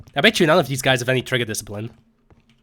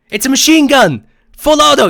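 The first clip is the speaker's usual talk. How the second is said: yelling; 12 semitones higher, 10 dB louder than usual. Real, not acted. Yelling in firefight about machine gun.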